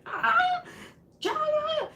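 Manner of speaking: very high-pitched squealed scream; mock scream. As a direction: imitate her panicked screech, way up high. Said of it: "she's screaming".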